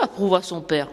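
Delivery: usual speaking voice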